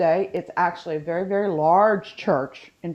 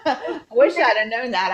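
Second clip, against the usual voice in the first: higher, louder, laughing regret; 6 semitones higher, 7 dB louder than usual. Real, not acted. Guest to co-host about missing the fairy ride, laughing. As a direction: say it through real laughter.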